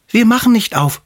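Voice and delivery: high, defiant little goats; kids calling out. A narrator's reading. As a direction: call out refusal in a tiny high voice. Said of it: "riefen sie". (trotzig rufende, hohe Geißleinstimme)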